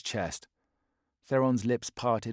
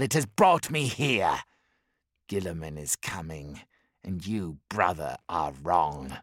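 brooding warlord; 5 dB louder than usual. Acted. Bellerophon telling his brothers of fate, brooding. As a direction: menace building toward revenge.

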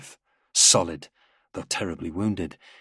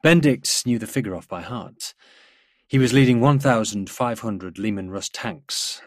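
ordinary delivery

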